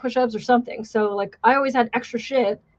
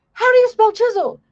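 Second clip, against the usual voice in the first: much higher, drill-instructor bark; yelling instructor; 12 semitones higher, 5 dB louder than usual. Retold or imitated. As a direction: call the recruit out like a drill instructor. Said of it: "the instructors yell at Contreras".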